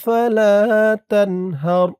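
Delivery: slow, drawn-out chanted recitation; Quran recitation. Shown as a demonstration. Teacher reciting the verse to learners, slow and drawn out. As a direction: stretch each vowel as in tajweed.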